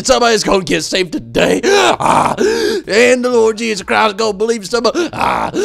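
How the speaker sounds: mock preacher catching his breath mid-yell; preacher mid-sermon. Imitating the preacher gasping between yells, hammed-up. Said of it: "that means he's about to probably say".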